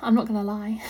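ordinary talk, nothing marked

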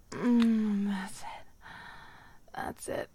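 normal talking voice

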